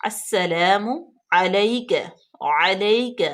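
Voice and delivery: "علیکہ" with a clear عین from the throat; careful recitation. Shown as a demonstration. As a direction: model "علیکہ" slowly. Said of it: "آئین کا مخرج واضح کریں".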